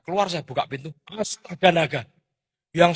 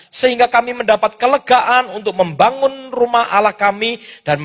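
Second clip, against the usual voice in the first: louder, measured Bible reading to the congregation; solemn reading from Ezra; scripture reading; 4 semitones higher, 8 dB louder than usual. Acted. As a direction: proclaim the verse with weight. Reads scripture aloud.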